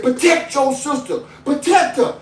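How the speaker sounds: high, firm command; retold command. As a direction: order them to protect her. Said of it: "I said".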